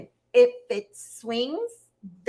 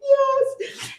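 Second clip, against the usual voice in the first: laughing. Real, not acted. Laughing over Spanish attempt.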